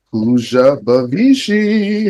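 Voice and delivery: stretched emcee introduction; excited guest announcement. Real, not acted. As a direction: announce the guest with a long, rising cheer. Host announcing a guest by name.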